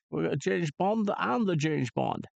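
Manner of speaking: ordinary talk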